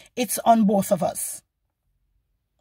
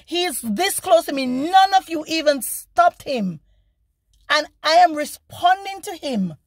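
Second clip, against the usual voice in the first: higher-pitched, emphatic, indignant complaint; indignant retold protest; 5 semitones higher than usual. Driver protesting to police about being threatened, retold indignation. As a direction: spell out each outrage in a raised pitch, protesting.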